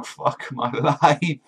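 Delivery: very slow, whiny despair; mock crying lament. Usopp, surrounded by dwarves, a slow mock-crying lament. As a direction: whimper it out like a wretched crybaby. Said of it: "he's just there crying".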